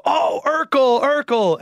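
high-pitched imitation of kids spotting a celebrity; excited kids calling out. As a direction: call out the name like thrilled kids.

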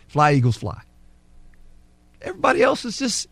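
ordinary talk

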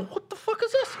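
high, stunned what-the-hell; shocked high-pitched disbelief. Retold or imitated. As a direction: squeak out the question in shock. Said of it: "I go".

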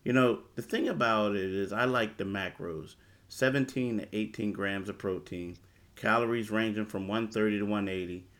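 upbeat sponsor read; hard sell ad. Acted. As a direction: sell it like a radio spot. Host reads sponsor ad.

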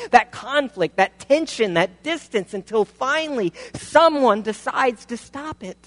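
high, loud, driving sermon delivery; preaching at full force. Acted. Pastor to congregation about endless enmity, full-force preaching. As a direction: pour it on like a revival preacher.

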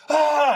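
short high-pitched demo yell; mock yell. Shown as a demonstration. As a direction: cry out to show what yelling would be. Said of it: "He wasn't yelling".

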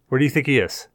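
ordinary talk

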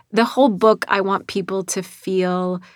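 ordinary talk

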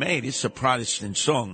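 ordinary talk, nothing marked

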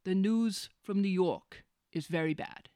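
a light Cuomo voice, blunt and simple; lightly voiced New York governor; plain-spoken Cuomo. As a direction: mimic Cuomo stating bad news flatly. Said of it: "he just says it simply".

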